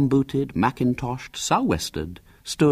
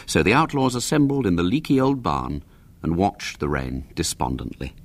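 ordinary delivery

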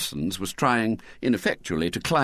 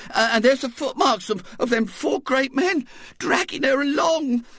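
tearful Missus Bott. A narrator's reading. Missus Bott tearfully telling her husband of the kidnapping. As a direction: quaver it out like a frightened mother.

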